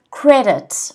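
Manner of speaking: Credit, first syllable strong; stress on CRE. Shown as a demonstration. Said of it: "can everyone repeat at home".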